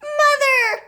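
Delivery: loud, high wailing Mother. A narrator's reading. Missus Cranky wailing at Grandma, high and loud. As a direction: wail it in horror. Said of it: "wailed Missus Cranky".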